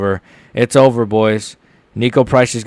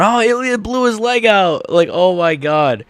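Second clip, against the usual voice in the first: mock shocked reaction; 9 semitones higher than usual. Imagined commentator reaction.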